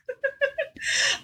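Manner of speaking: quick high chuckle; amused at his own past; high laugh. Real, not acted. Laughing before telling the host about his burger story, high and quick.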